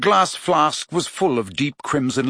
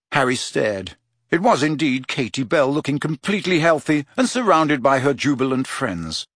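ordinary delivery

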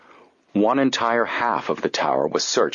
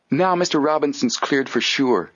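higher-pitched, pleased exclamation; exclaiming; 7 semitones higher than usual. A narrator's reading. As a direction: exclaim with relief at good news. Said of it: "Joe exclaimed".

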